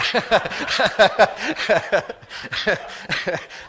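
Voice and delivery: loud bursting laugh; high loud giggle; embarrassed laugh. Real, not acted. Embarrassed laugh mid-story.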